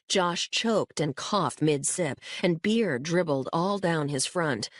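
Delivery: plain reading voice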